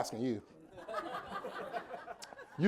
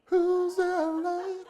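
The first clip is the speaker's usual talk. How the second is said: squeaky playful question; much slower than usual. Acted. High playful aside.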